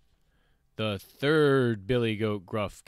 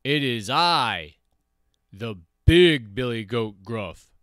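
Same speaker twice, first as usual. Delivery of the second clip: loud big-goat voice; 7 dB louder than usual. A narrator's reading. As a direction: announce yourself loudly and weightily. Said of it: "his voice was as loud as the troll's".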